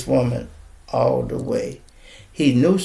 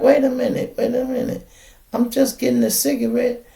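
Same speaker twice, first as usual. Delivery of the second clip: imitating the woman; 11 semitones higher than usual. Woman stalling the cop.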